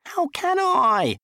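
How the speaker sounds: slow, very high wailing whine. Acted. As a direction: moan it in despair. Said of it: "he wailed".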